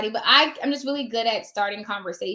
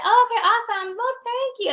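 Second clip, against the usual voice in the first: polite receptionist; 11 semitones higher than usual. Retold or imitated. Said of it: "then they'll say".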